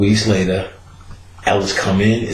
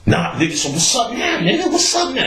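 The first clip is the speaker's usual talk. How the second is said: much higher, taunting challenge; Ellis challenging; 13 semitones higher than usual. Retold or imitated. Ellis daring the guard to fight, high taunting challenge. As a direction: square up, taunting him.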